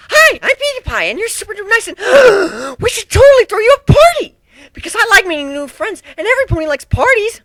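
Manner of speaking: very high, very loud, bubbly party pony with a gasp; Pinkie Pie voice. Acted. Pinkie Pie gushing to a new friend about throwing a party, very high and loud. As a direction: bounce through it with giddy over-the-top excitement.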